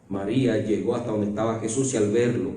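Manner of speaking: ordinary talk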